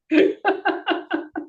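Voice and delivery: a high, real laugh bursting out; burst of laughter. Real, not acted. Speaker laughing at her cousin's remark, high laugh. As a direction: laugh it off at the end of the story.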